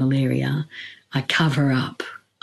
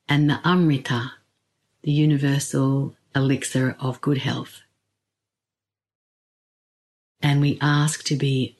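guided meditation. Acted. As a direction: ease them in with long pauses.

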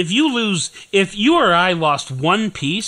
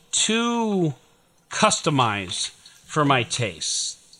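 ordinary talk, nothing marked

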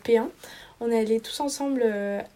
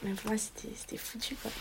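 whispered; 12 dB quieter than usual. Real, not acted. Unvoiced, very quiet.

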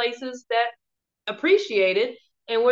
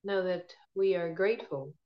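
guided meditation; 5 dB quieter than usual. Acted. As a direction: ease them gently into calm.

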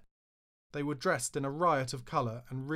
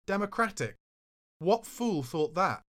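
higher, scoffing repeat of his word; scornful disbelief; 7 semitones higher, 4 dB louder than usual. Retold or imitated. To Charlie over the tube plan, scornful disbelief. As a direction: throw the word back at him, mocking.

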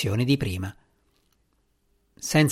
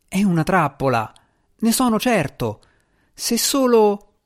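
higher, uneasy cat spirit voice; fretful little djinn, unsure; nervous djinn cat; 8 semitones higher than usual. Acted. Il gatto avverte. (gatto djinn inquieto, voce più alta)

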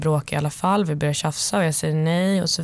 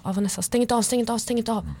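higher-pitched, rushed mock begging; imitated pleading; 5 semitones higher, faster than usual. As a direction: beg them to stay on the line, lightly acted. (härmat, enträget bedjande)

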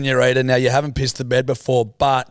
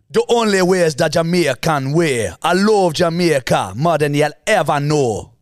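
hammed-up Jamaican accent, sing-song; 2 semitones higher, 3 dB louder than usual. Shown as a demonstration. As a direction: put on a lilting Jamaican accent. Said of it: "if I pull out a Jamaican".

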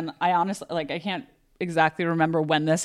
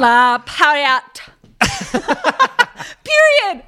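high, loud and drawn-out camp cry; camp exclamation; 10 semitones higher, 8 dB louder, slower than usual. Pretend. Friend hamming it up, a high camp squeal.

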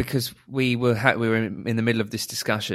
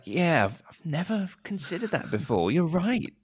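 high, soft pretend revelation; mock agreement; 9 semitones higher, 3 dB quieter than usual. Teacher retelling a reply to her colleague, mock agreement. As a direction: feign being won over, pitched high.

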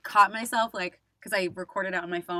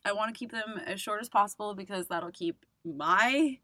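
ordinary talk, nothing marked

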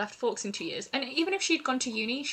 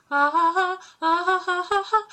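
high, loud, lilting ha-ha call; Esme calling the deer; 9 semitones higher, 9 dB louder than usual. Retold or imitated. Esme calls deer in the forest, high lilting call. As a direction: sing out a bright high call to the woods. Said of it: "goes in the forest and goes".

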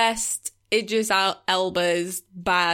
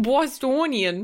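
imitating Bostonian; 4 semitones higher, slower than usual. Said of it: "It's just like a Bostonian accent".

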